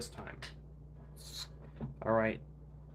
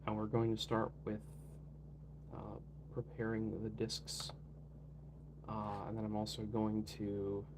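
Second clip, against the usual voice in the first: ordinary talk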